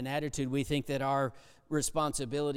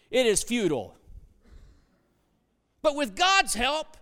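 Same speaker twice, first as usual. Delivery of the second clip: raised, louder preacher's drive; preaching at full force; 10 semitones higher, 4 dB louder than usual. Acted. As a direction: pound the point home to the congregation.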